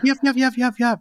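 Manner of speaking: high, quick little yips of a puppy; yappy puppy voice. Retold or imitated. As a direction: imitate the puppies yapping in the background. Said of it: "it's like".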